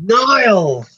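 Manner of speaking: loud, high cheer of the name; playful cheer. Pretend. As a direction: hail him like a long-awaited pal. Players cheer Niall finally answering.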